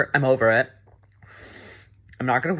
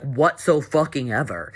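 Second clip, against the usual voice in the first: ordinary talk, nothing marked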